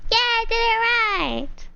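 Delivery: high, drawn-out triumphant yeah; delighted self-congratulation; proud cheer. Real, not acted. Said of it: "Did I do it right?".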